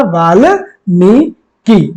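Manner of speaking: Valmiki drawn out very slowly, each syllable clear; very slow dictation of Valmiki; slow careful model. Shown as a demonstration. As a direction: spell out Valmiki slowly for students. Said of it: "क्या होता है?".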